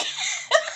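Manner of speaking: very high airless giggle; can't hold the laugh in; breathy squeal of laughter. Real, not acted. Laughing at husband's misery.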